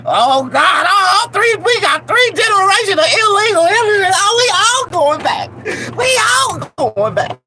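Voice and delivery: high, loud fake crying wail; mock panicked wail. Panicking family member wailing high and loud. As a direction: wail like the whole family is being sent back.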